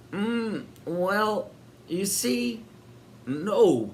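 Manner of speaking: high, halting splutter. A narrator's reading. Sid flustered about carrying the baby, spluttering. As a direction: trip over the words. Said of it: "spluttered Sid".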